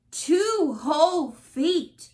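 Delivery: loud, slow, stretched out; dramatic exclaim. A narrator's reading. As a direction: make the loss sound huge. Shrinking worsens, dramatic exclaim.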